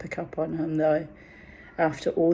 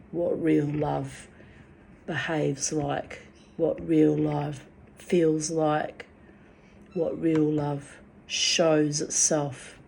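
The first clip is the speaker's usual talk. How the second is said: slow, each word weighed; deliberate slow insistence; slow emphatic; slower than usual. Real, not acted. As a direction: lean on real love, drawn out. Insisting what real love is.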